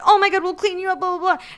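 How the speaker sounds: high, loud flustered parents; hammed-up parental panic; mock fussing parents. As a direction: fuss in a put-on high voice.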